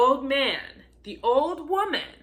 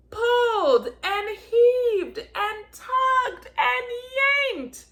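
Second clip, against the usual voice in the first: slow, higher and louder with strain; straining effort; 7 semitones higher, 4 dB louder, slower than usual. A narrator's reading. Reading the pull to children, straining hard. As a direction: heave it out like the biggest pull yet.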